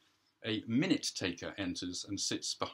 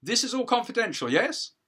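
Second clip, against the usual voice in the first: a higher, quicker wife voice; wife checking anxiously, pitched up; wife asking the judge if it is all confidential, higher; defendant's wife; 13 semitones higher, 8 dB louder than usual. Acted. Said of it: "Defendant's wife".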